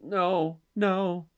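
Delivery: put-on child's frightened no, repeated; imitating scared kid. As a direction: mimic a child's night terror. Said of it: "and he's like".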